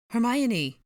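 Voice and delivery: loud, high searching call. A narrator's reading. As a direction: call for your missing friend. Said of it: "Ron asked".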